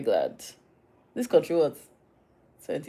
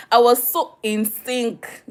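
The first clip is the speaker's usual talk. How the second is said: high, loud and drawn out; slow, delighted brag; gleeful boast; 7 semitones higher, 7 dB louder, slower than usual. Real, not acted. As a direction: stretch it out proudly, word by word. Boasts of unplanned matching colour.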